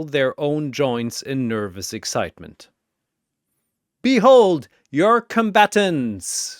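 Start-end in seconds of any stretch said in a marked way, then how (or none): 4.0-6.6 booming, high, loud proclamation; thundering arena announcer; thundering announcement. Acted. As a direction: bellow it across the arena. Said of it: "Zurubang's voice thunders across dimensions".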